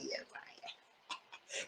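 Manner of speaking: breathy whispered tease; a mocking whisper under the breath; teasing whisper. Real, not acted. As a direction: whisper it like a sly aside. Teasing aside to himself.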